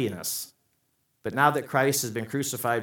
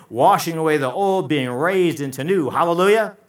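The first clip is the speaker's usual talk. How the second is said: loud, raised pulpit proclamation; full-force preaching; 5 semitones higher, 8 dB louder than usual. Real, not acted. Preacher on baptism raising us to new life, full-force preaching. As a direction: build to a ringing hallelujah.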